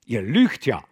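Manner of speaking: stern abbot calling them out; reproachful. Acted. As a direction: rebuke the liars. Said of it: "sprach tadelnd der Abt".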